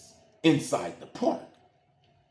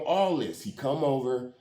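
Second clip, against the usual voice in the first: normal talking voice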